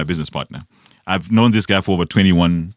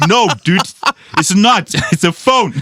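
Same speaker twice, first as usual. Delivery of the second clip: high-pitched comic protest; exasperated correction, voice jumping up high; mock exasperation; 10 semitones higher, 3 dB louder, slower than usual. Setting phone users straight about clocks, in mock exasperation.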